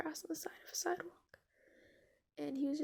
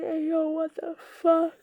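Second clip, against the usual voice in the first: loud, drawn-out mock bewilderment; slow, loud outburst of cartoonish confusion; bamboozled; 10 dB louder, slower than usual. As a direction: act utterly baffled, stretching the words out. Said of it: "he's really bamboozled".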